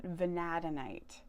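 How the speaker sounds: "Vanadinite" as vuh-NAD-uh-nite, ending on a fall; corrected pronunciation. Real, not acted. Confirming how the mineral is pronounced, "Vanadinite" settled as vuh-NAD-uh-nite. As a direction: stress the second syllable of "Vanadinite", settled and sure. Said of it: "that's how you pronounce it".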